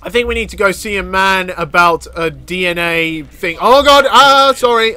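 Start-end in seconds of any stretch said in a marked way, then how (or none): 3.6-5.0 startled yelp. Real, not acted. Startled mishap in game.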